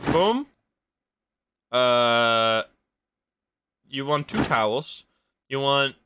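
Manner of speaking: ordinary delivery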